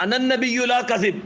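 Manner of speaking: drawn out like a battle cry; proclaiming call. Retold or imitated. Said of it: "آپ نے فرمایا".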